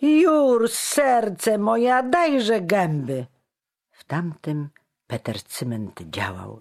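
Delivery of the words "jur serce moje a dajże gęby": very high, loud, exuberant shout; joyful yell. A narrator's reading. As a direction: bellow affectionately to a dear friend. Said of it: "ramiona szeroko rozłożył i wrzasnął".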